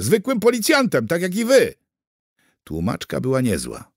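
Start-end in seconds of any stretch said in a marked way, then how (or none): 0.0-1.7 high, loud protest; frightened pleading. A narrator's reading. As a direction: plead loudly as a scared prisoner. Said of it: "Kuger się przestraszył". (wystraszony, podniesiony głos)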